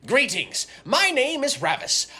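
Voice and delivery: high, formal wizard voice; loud theatrical fantasy wizard; dragonborn wizard. Acted. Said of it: "I am a dragonborn wizard".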